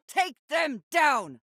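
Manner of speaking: high, slow shouted order; rally the crew to attack, drawn out; commanding combat cry over the fight; battle shout. Acted.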